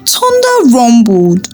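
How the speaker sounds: ordinary delivery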